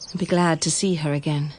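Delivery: ordinary talk, nothing marked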